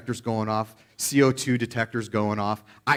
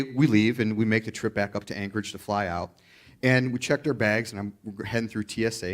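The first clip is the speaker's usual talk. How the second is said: ordinary talk, nothing marked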